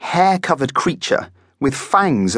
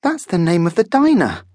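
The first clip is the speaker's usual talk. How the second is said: put-on high boy's voice, still nervous; slightly squeaky; 8 semitones higher than usual. A narrator's reading. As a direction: squeak it out as a surprised boy. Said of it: "still slightly squeakily".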